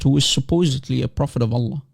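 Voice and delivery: usual speaking voice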